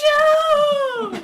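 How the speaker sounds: high, loud anguished no; anguished cry. Acted. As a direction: scream it in despair.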